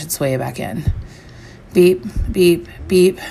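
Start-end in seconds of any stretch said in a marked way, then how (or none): 1.7-3.2 short, evenly spaced beeps held on one pitch; imitated alarm beeps. A narrator's reading. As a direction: mimic a timer going off, beep by beep. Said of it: "What is that sound?".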